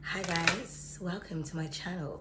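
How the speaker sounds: mock intro in a low, husky voice; deep-voiced host. Silly fake channel intro in deep voice. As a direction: play a sultry low YouTuber. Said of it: "I'm just being silly".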